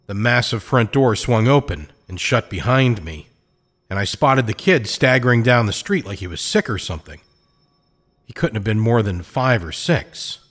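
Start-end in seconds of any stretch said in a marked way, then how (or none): none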